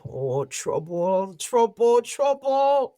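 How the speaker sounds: put-on high, loud wailing old woman; old woman crying out. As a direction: wail trouble, rising each time. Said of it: "she would cry out".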